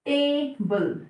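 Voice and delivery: Table split into two separate beats; syllable by syllable. Shown as a demonstration. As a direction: say टे, then बल, slightly apart. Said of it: "table दो syllable से मिलकर बना है". (Table को दो हिस्सों में तोड़कर बोलें)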